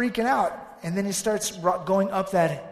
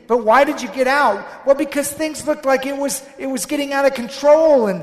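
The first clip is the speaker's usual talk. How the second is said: agitated pleading; 6 semitones higher, 10 dB louder than usual. Acted. Panicked self-defence to Jesus.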